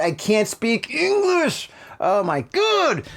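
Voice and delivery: hammed-up distress; mock upset. Real, not acted. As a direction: play up being too upset to talk. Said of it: "I'm so upset".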